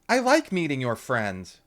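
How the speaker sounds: high, loud cheerful mom; breezy mother brushing off worry; bright mom voice; Lauren's mother. A narrator's reading. Mother repeats herself eerily.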